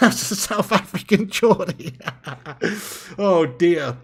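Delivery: giggling at his own botched accent; laughing. Real, not acted. To the viewers, laughing at his South African Geordie. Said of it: "that was pretty messed up".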